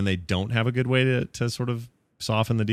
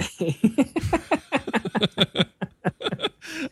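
high laugh. Real, not acted. Laughing at own contest.

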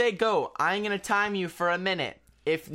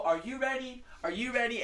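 announcer voice; 6 semitones higher, 3 dB quieter than usual. Shown as a demonstration. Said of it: "it's gonna go".

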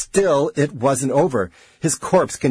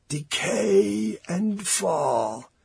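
very slow, high quaver; 7 semitones higher, 4 dB quieter, much slower than usual. A narrator's reading. Rapidly aging man speaking from the chair, slow quavering. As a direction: draw each word out in a wavering wail. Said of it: "Corrigan said in a quavering voice".

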